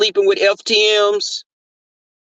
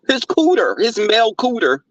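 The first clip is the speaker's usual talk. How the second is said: mocking; 6 semitones higher than usual. Mocking cohost's story.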